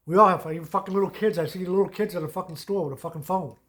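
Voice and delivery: fired-up disbelief; real rant. Real, not acted. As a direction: vent it at the listener. Ranting about scam excuse.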